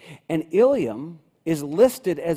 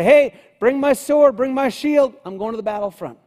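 loud high call down from the roof; calling downstairs; 7 semitones higher, 9 dB louder than usual. Pretend. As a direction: shout orders down to the servants. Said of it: "could have called downstairs off of the roof".

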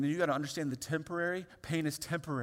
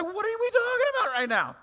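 much higher, louder mock teenager voice; baffled teen; 10 dB louder than usual. Preacher imitating a teenager asked about retirement, baffled. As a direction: play the confused teenager. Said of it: "You're gonna be like".

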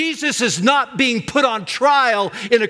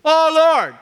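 loud, high cry to God; praying cry; 9 semitones higher, 5 dB louder than usual. Retold or imitated. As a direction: call out high and hard, as in prayer. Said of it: "When I first started praying these psalms, I would go".

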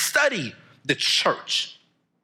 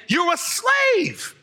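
preaching shout; much slower than usual. Real, not acted. Preacher shouting what scripture calls debtors, full force. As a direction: thunder the verdict at the crowd.

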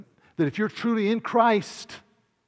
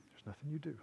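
much softer, lower confirmation; quiet reply; 3 semitones lower, 17 dB quieter, faster than usual. Acted. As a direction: answer the questioner quietly and simply. Preacher answers the acted listener.

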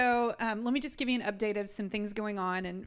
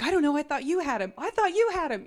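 high, loud, rushed i don't know; flustered quick answer, pitched up; frantic family reply; 5 semitones higher, 7 dB louder than usual. Retold or imitated. Family members scrambling to answer where Truman is, high and loud. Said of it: "everybody's like".

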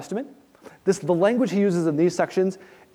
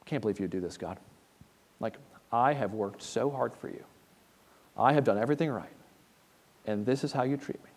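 softer, lower, self-pitying grumble to God; sulky muttering at God, much softer; quiet muttered complaint; 5 semitones lower, 10 dB quieter than usual. Retold or imitated. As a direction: mutter it under your breath like a hurt servant. Imitating a believer grumbling at God.

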